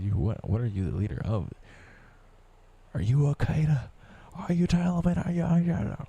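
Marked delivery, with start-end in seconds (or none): 3.0-6.0 mock interrogator. Mocking imagined accuser, higher mock interrogation. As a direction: grill yourself like a suspicious cop. Mock-questioning himself, raised voice.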